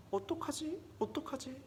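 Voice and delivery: high, soft anxious questions; small, helpless acted worry; worried fretting. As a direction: fret under your breath, voice lifted high. Villain's friends fret over doom.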